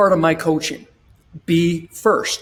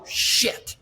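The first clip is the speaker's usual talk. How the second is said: a secret, unvoiced curse; whispered curse; 5 dB quieter than usual. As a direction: whisper it under your breath. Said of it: "secretly she's going".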